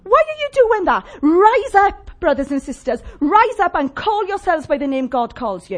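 loud, high rallying preaching; rally the congregation to rise; fiery revolutionary speech. Acted. Preaching climax, rise up call.

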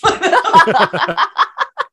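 high cackling witch laugh; witch cackle. Pretend. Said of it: "that witch voice we were practicing earlier".

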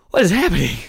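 loud, high, shocked cry; shocked outcry. Real, not acted. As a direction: shout it in disbelief. Shocked at roster.

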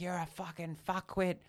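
high-pitched mock telling-off, held back in volume; imitated rant. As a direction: mimic an indignant stranger. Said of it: "still just ranting at me".

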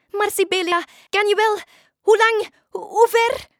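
high, loud, stumbling questions; flustered witch. Acted. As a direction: blurt out worried questions. Witch doubts the dolphin's plan. (paniekerige, hoge vragen)

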